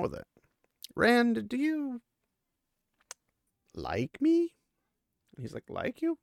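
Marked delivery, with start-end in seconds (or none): 0.9-4.5 high, halting question with a long pause; hammed-up timid lovestruck question; nervous confession. Girl blurts out nervous question. 5.7-6.2 high, rising mock surprise; puzzled echo question. Puzzled boy echoes her question.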